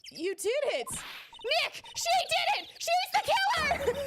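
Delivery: shrill high yell of triumph; high, loud, gleeful accusation; excited accusation. Acted. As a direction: scream it out like you cracked the case. Voicing excited Maya accusing witness.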